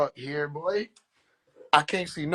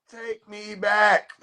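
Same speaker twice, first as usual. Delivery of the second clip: drawn-out plea; 4 semitones higher, much slower than usual. Pretend. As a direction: stretch "Take me back." out slowly, higher and pleading. Pleading take, drawn out.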